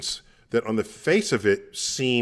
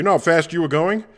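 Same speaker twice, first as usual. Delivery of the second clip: cop voice; 7 semitones higher, 4 dB louder than usual. Retold or imitated. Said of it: "the cop stops you and it says".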